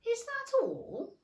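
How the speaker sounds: high, indignant question, anger held down; suppressed anger. Acted. Alice holding back anger at the caterpillar, high and indignant. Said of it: "swallowing down her anger".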